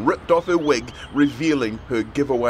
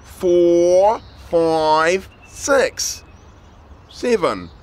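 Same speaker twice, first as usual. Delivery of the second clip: louder counting, one by one; 5 semitones higher, 5 dB louder, much slower than usual. A narrator's reading. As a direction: count out loud, pointing at each. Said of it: "count a little louder".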